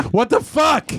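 high-pitched exasperated shout; mad yell. Retold or imitated. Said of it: "So I get very mad at the mouse".

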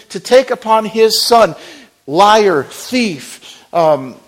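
full-force preaching. Acted. Preacher pleading with the church at full force. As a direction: drive it home to the congregation.

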